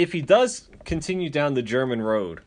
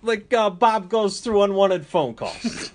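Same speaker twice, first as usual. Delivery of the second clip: high, slow teasing voice; mock slow voice; 7 semitones higher, 4 dB louder, slower than usual. Ribbing a friend about Bob in a high slow voice. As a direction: drag it out like a goofy impression.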